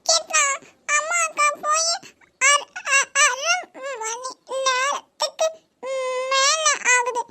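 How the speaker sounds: put-on high, slow, tearful child's whine; a little girl sobbing for her uncle's attention; whiny child crying. Niece cries to her uncle to look at her.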